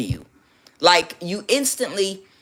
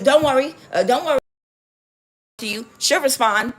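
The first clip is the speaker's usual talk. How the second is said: high, loud, hurried reassuring; hammed-up "don't panic" voice; mock reassurance; 7 semitones higher, 4 dB louder than usual. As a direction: act out a flustered coach calming someone. Coaches hurriedly reassuring him.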